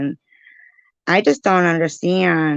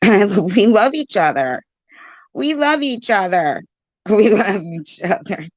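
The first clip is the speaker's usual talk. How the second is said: laughing. Real, not acted. Streamer pleading for unity with chat, laughing. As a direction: laugh through the declaration.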